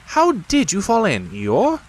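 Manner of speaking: high, self-pleased rabbit voice. A narrator's reading.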